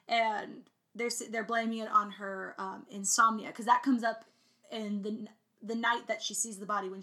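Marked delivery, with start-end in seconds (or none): none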